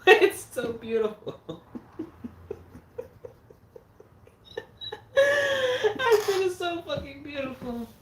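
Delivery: high, loud words squeezed out through laughter; crying with laughter; laughing. Real, not acted. Gushing to chat about Troll 2 through tears of laughter.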